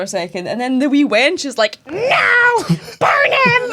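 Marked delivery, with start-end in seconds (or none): 1.9-3.7 high, loud hammed-up outcry; exaggerated piercing yell of condemnation; mock shriek. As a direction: screech it like an outraged wench. Wench's outcry in retold scene.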